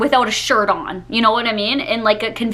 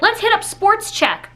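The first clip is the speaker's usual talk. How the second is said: mock customer; 8 semitones higher than usual. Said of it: "people are like".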